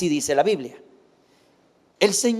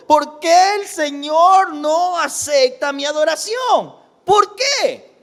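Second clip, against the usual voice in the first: acted wail, pitched up; pleading cry; 7 semitones higher, 3 dB louder, slower than usual. The weeping people asking God why, in a hammed-up wail. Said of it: "claman llorando".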